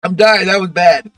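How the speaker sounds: sputtering and wheezing from a bad inhale, real; choke, laugh and gasp between words; coughing and laughing fit. Real, not acted. Streamer to chat after inhaling too much, coughing and laughing for real. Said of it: "that inhale was a little too much".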